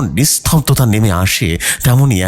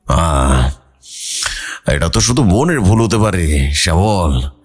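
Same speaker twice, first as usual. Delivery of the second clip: lower, measured village priest; calm elder advising the father; old priest; 3 semitones lower than usual. A narrator's reading. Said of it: "তিনি বললেন". (বাবাকে পরামর্শ দেওয়া বৃদ্ধ পুরোহিত, ধীর নিচু গলায়)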